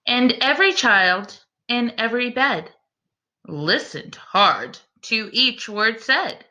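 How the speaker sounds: slow, lilting bedtime rhyme reading; story narration. A narrator's reading. Reading a rhyming bedtime book to the class, gentle storyteller voice. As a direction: read it like a picture book to little ones.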